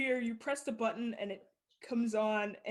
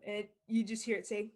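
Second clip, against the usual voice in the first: ordinary talk, nothing marked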